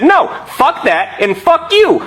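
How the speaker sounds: rising furious rant; angry rant. Retold or imitated. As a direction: blow up at the mayor.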